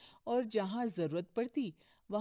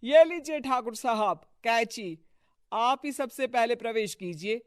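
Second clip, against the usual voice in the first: raised, eager host voice; deferential hosting; 3 semitones higher, 11 dB louder than usual. Acted. Host offering Thakur the scissors, eagerly deferential. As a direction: loudly usher the honoured guest in. Host urging honoured guest forward.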